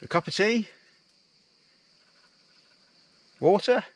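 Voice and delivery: high, put-on polite voice asking for ale; jokey knock-and-ask at an old chapel; mock visitor at the door. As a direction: play a hammed-up thirsty traveller at a chapel door.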